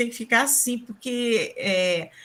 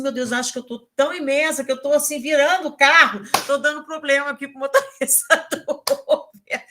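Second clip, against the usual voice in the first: high, louder, hammed-up dismay breaking into laughter; retold self-conscious dismay; 5 semitones higher, 5 dB louder than usual. Passenger telling of her worry in the Uber, mock dismayed. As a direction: exaggerate the embarrassment and laugh at yourself. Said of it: "mas eu me senti mal".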